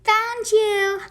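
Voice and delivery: a gleeful found-you; laughing. A narrator's reading. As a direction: catch them out with a laugh. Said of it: "I laughed".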